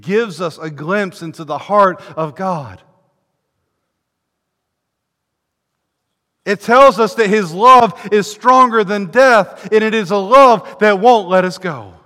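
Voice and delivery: preacher exalting God's love before the church; climactic preaching. Acted. Preaching at full force.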